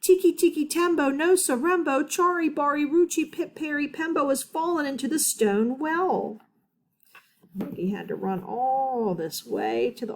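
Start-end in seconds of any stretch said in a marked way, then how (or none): 0.0-6.4 high, urgent calling; urgent shout. Acted. Chang calling the old man, high and urgent. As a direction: hurry him with the whole long name. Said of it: "shouted Chang".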